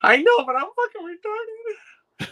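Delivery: high, drawn-out self-mocking whine; mock wail. Real, not acted. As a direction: wail it loudly and slowly, half laughing. Mock wail admitting own foolishness, then laughs.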